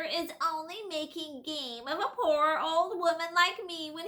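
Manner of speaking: put-on high, slow, fawning old-woman whine; shrill whining hag. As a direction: wheedle like a crafty old hag. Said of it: "the shrill, whining voice began".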